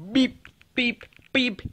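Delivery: high robotic beep beep; rabbit beeping. Pretend. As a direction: chirp like a little beeping bunny. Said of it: "that's why I was like, "Beep, beep".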